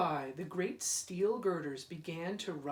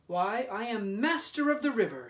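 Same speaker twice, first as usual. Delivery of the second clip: proud; 4 semitones higher, 6 dB louder than usual. Acted. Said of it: "felt bigger and prouder".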